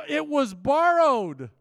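very loud, high, drawn-out wail; dismayed cry. Retold or imitated. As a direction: cry it out to the prophet in dramatic dismay. Acts out man losing axe head.

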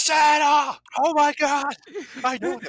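high, loud, thrilled elf shout; Buddy the Elf. Retold or imitated. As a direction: squeal it like an overexcited elf. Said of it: "my best Buddy the Elf impression".

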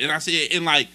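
normal talking voice

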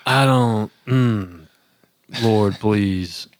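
weary private prayer. Retold or imitated. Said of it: "legit private prayer sounds more like".

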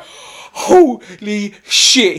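a slow gasp of disbelief; stunned exclamation. Retold or imitated. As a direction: say it slowly, floored by what you heard. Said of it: "I was like".

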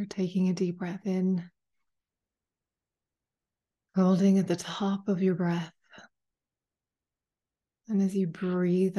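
calm meditation guide, long pauses between phrases; unhurried, spacious guided-meditation delivery. Acted. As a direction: ease listeners into stillness, phrase by phrase. Leads a guided meditation after chat.

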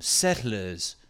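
"Settlers" in a put-on settler accent, R dropped; imitation colonial accent on "Settlers", no R at the end. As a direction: say "Settlers" in a mock old settler accent, ending "-luhz". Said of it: "We always assume they sound like this".

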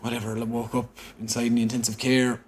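normal talking voice

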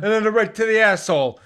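high loud cartoon killer; caricature killer. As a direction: boast absurdly. Bit continues.

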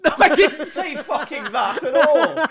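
high, loud mock outrage through laughter; mock outraged protest. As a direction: protest like an offended customer. Offended character laughing.

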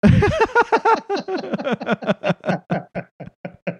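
long, high-pitched cackling laughter; helpless laughter that tails off. Real, not acted. Host laughs at co-host's quip about R J, cracking up.

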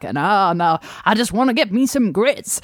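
badly faked Southern American accent. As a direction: put on a terrible Southern American accent, mid-continent. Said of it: "apologies to anyone for that accent".

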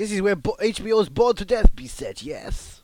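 put-on loud, high Russian accent; mock Russian accent. Host as a Brighton Beach local, mock Russian accent.

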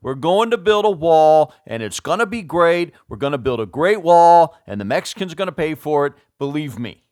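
impresi amatir, lantang dan menekan kata; Donald Trump. Acted. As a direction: do a rough Trump impression, loud and punchy. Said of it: "I don't do a great Trump accent".

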